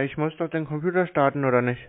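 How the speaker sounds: usual speaking voice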